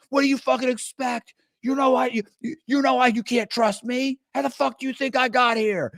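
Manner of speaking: high, squeaky gloating taunt; mock taunting. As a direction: sneer at the sucker who trusted you. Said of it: "they'd say shit like".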